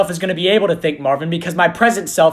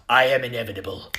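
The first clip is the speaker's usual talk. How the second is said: Thanos; 7 semitones lower, 6 dB quieter than usual. Pretend. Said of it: "you're telling me I am Thanos".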